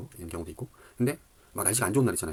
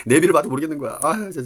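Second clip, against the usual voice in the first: frustrated outburst; 7 semitones higher, 9 dB louder than usual. Real, not acted. Lost, navigation not helping.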